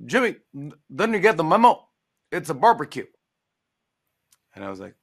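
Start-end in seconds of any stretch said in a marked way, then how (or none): 0.1-3.1 higher, louder teasing voice; imitating the president. President teasing Jimmy about his suit, louder mock voice. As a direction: loosely play the president ribbing him. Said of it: "he goes".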